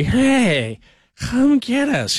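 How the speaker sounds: high, slow, drawn-out mock drunk call for a ride; lightly hammed-up Harry Carey, dragging out come get us; Harry Carey impression. Host imitating Harry Carey calling his daughter for a ride, drawn out and slow. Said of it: "I'm not Harry Carey".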